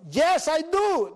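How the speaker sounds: high, loud, very drawn-out holler; shouted answer. Retold or imitated. Rooftop man replying to the friend, calling back slowly. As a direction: stretch it out from far above.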